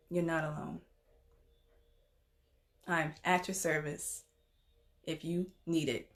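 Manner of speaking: soft, low, with long pauses; gentle reassurance. Real, not acted. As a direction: quietly comfort someone who is struggling. Reassuring viewers who are struggling.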